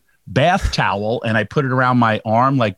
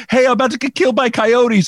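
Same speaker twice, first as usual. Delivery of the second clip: frantic call; 11 semitones higher, faster than usual. Retold or imitated. Yelling to passing driver.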